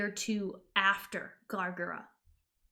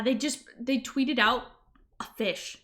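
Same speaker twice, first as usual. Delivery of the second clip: ordinary talk, nothing marked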